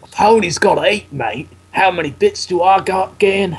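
mock Aussie read; Australian accent. Shown as a demonstration. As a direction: show how Australian sounds. Demonstrates Australian accent after co-player's failed try.

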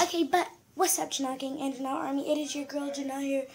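usual speaking voice

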